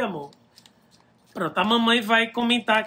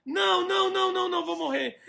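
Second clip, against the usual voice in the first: frantic high shouted panic; yelling as the game kills you; panicking gamer; 7 semitones higher than usual. Real, not acted. Water rising, character dying.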